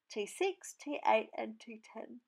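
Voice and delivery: words stretched through a yawn. Real, not acted. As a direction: talk mid-yawn. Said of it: "I'm already yawning".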